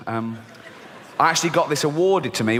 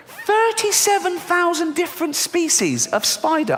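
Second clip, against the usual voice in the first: repeated much higher in disbelief; high incredulous; 12 semitones higher than usual. Shown as a demonstration. Dreading spiders, repeating the number high and aghast. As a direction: squeak it again in horror. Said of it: "repeated higher".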